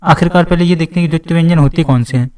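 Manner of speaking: ordinary delivery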